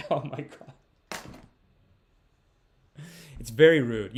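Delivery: usual speaking voice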